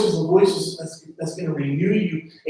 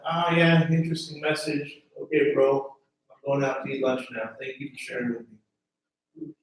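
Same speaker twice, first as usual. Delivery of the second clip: lower, offhand mock shrug; dismissive brush-off; 5 semitones lower, 5 dB quieter than usual. Preacher mocking a listener who shrugs off Jesus, offhand. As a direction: play a bored listener brushing it off.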